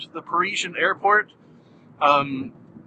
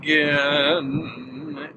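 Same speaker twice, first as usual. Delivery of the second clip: slow, drawn-out robot voice winding down; robot powering down; 3 semitones higher, much slower than usual. Pretend. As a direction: play a machine shutting off slowly. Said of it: "Working robot powering down".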